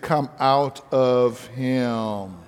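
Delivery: slow, low, commanding; a drawn-out Come out of him; power and authority. Retold or imitated. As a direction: lay each word down with authority. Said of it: "you speak with power and authority".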